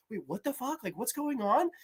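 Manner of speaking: confused. Retold or imitated. His own confused first reaction.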